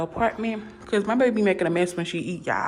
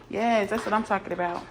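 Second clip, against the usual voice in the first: ordinary talk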